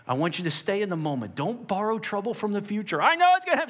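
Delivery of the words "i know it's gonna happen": much higher, loud panicky cry; high, loud anxious outburst; mock dread. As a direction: blurt it like a doom-sayer. Voicing a worrier's dread.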